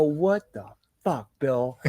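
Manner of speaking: slow, drawn-out disbelief; exasperated. Retold or imitated. Dad to son who missed the wave, slow exasperated disbelief. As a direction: let the frustration stretch each word out.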